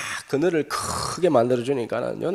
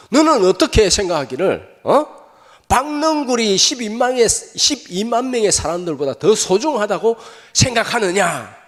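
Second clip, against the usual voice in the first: higher and louder, slow, pressing the question; rising rebuke; 8 semitones higher, 6 dB louder than usual. Acted. God presses Jonah, rising to a loud slow rebuke. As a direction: build to a pointed divine rebuke.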